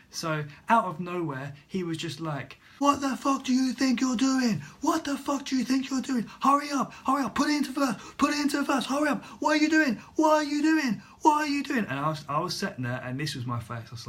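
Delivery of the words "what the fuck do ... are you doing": loud, high, frantic shouted tirade; losing his mind. Retold or imitated. Instructor to learner stalled on roundabout, furious acted shouting. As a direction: imitate an instructor exploding at a learner. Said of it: "he just lost his mind".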